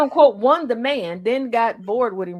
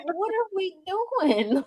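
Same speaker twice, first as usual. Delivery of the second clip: high, drawn-out bafflement; baffled protest; 7 semitones higher, 4 dB quieter, slower than usual. Retold or imitated. Questioning eighty-year-olds planning an escape, baffled and high. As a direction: question it slowly, pitched way up.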